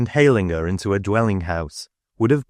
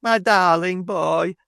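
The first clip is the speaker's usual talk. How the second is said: trembling plea; 11 semitones higher, slower than usual. A narrator's reading.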